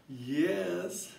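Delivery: ordinary talk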